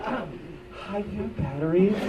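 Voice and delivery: high, soft, smitten greeting; awkward around cute women. Retold or imitated. Idiot talking to a cute woman, awkward. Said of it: "I'm a complete idiot when I talk to cute women".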